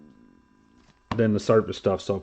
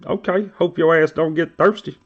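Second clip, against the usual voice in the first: mocking Landon; 7 semitones higher, 4 dB louder than usual. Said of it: "He's like".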